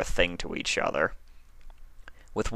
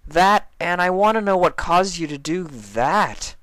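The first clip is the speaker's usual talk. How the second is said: Spike's voice; 12 semitones higher, 5 dB louder than usual. A narrator's reading. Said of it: "Spike added".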